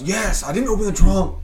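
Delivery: mock-anxious, pitch jumping up; high-pitched nervous denial, retold; imitating scared friend. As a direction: protest like you're getting rattled. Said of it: "Sean was getting really scared".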